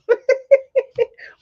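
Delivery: sudden high laugh at herself; high burst of laughter. Real, not acted. Laughs at own mix-up.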